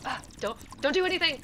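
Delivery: loud, high, frantic shouting; Kara begs the party to freeze while she fixes it; high panicked yelling, words tumbling out. Acted. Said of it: "she's like".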